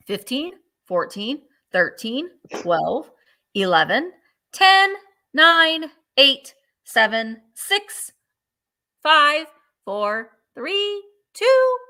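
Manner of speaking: rising excited countdown; building countdown. Real, not acted. Countdown to end of auction.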